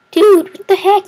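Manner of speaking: real startled yelp; shocked outcry. Real, not acted. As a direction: blurt it out in disbelief. Enemy suddenly grew.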